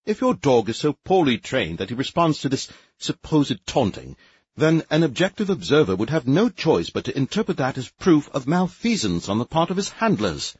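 haughty bureaucrat lecturing the captain; officious inspector. A narrator's reading. Sneef character voice, higher.

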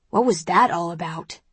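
ordinary delivery

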